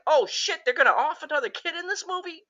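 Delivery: high, fast alarmed exclaim; shocked. Retold or imitated. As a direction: gasp it out in disbelief. Viewer shocked at the scene.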